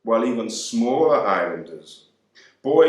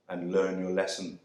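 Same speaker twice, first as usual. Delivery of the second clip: usual speaking voice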